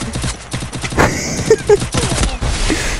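high airy burst of laughter. Real, not acted. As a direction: laugh in disbelief. Laughs after surprise.

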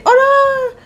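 high, loud startled "Oh là!"; shocked yelp of surprise; startled cry. Real, not acted. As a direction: jump at the sudden ghost. Angry little devil ghost appears.

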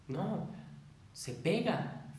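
ordinary talk

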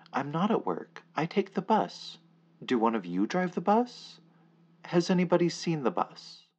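high, loud, frantic asking. Acted. Narrator pleading with silent men in suits, loud and frantic. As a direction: call out to the crowd, getting desperate.

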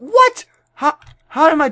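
very high startled "what"; shocked squeak. Real, not acted. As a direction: jump way up in disbelief. Sudden death in game.

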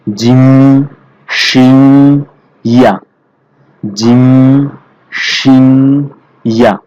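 জিম, শিং, ইয়া said firmly with the mid tongue; firm mid-tongue letters. Shown as a demonstration. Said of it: "শক্ত করে". (জিহ্বার মাঝখান দিয়ে শক্ত করে জিম, শিং, ইয়া)

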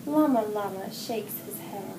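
ordinary delivery

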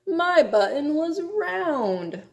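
high, slowing, drawn-out complaint; theatrical storybook lament; wailing. A narrator's reading. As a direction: stretch the key words into a wail. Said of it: "wailed Toad".

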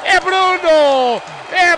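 slow, loud scorer shout; explosive goal commentary. Real, not acted. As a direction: shout the scorer's name, dragged out.

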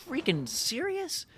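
very high, slow disbelieving squeak; real disbelief; incredulous. Real, not acted. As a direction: stretch it in outraged disbelief. Opponents took the lead.

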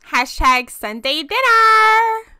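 loud, high, stretched-out cheer; mock-celebratory announcement. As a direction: call it out slowly, drawing out the last word. Announcing the family dinner.

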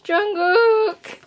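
high, loud fangirl cry of his name; excited squeal. Real, not acted. Fan shrieking at her idol's photo. Fan reacting to seeing idol.

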